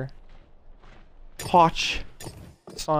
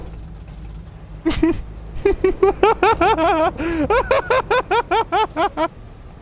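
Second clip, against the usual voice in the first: helpless high-pitched cackling; long high laughing fit; 3 dB louder than usual. Real, not acted. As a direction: crack up, laughing on and on. Cracking up at confused player.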